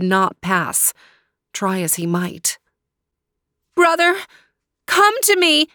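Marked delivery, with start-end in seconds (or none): 3.8-5.7 put-on high, pleading young girl voice; high young sister pleading; girl calling out. A narrator's reading. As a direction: call to your brother through the wall. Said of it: "called Natalia through the invisible wall".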